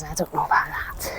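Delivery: voicing the horse in a hushed whisper. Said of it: "He says".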